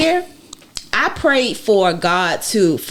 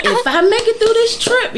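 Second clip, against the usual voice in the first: high laughing through the words; bubbling over with laughter. Real, not acted. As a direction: laugh it out, giddy. Laughing while retelling.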